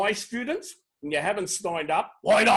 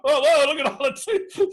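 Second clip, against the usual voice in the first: high, loud delighted cry; an excited host cheering his audience; 8 semitones higher, 5 dB louder than usual. Real, not acted. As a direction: gleefully point out the flood of twos. Excited at chat filling up.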